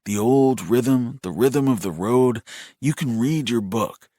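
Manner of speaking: slow, lilting cadences with stretched words; sing-song soothing. A narrator's reading. Brother calming his shaking brother, soothing sing-song. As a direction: lull him with a steady, rhythmic chant. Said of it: "Resorting to the sing-song cadences".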